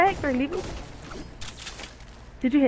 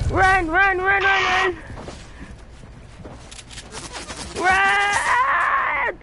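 screaming; 7 semitones higher, much slower than usual. Real, not acted. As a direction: scream at them to run. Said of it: "I just lost my voice from screaming".

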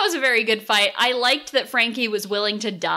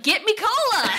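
put-on southern accent; 13 semitones higher than usual. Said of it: "But in a southern accent".

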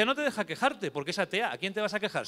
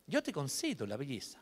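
softer, hushed fairy blessing; fairy's gift; 3 semitones lower, 7 dB quieter than usual. Acted. The good fairy blessing baby Teresa, hushed. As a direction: bestow it gently like a good fairy. First fairy grants beauty.

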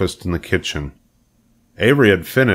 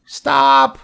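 very high piglet shriek; terrified scream; 5 dB louder than usual. A narrator's reading. Wilbur interrupts the sheep's tale of shooting, screamed. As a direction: cut in with a panicked squeal. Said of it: "screamed Wilbur".

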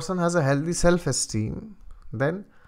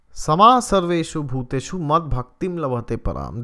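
louder, chanted Sanskrit verse; verse recitation; 5 dB louder than usual. Acted. The preacher quoting Krishna in the Gita, chanted recitation. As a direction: intone the shloka with weight.

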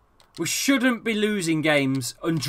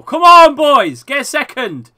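loud, high rallying yell; urging shout; 9 semitones higher, 13 dB louder than usual. Real, not acted. Gamer urging the boys to get a second, rallying shout. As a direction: spur the team on, starting at full volume.

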